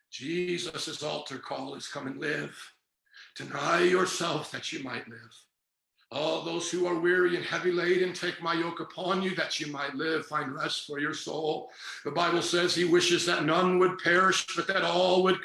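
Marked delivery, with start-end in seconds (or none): 0.1-11.7 gentle invitation. Real, not acted. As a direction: invite the weary in tenderly. Gentle invitation after shouting.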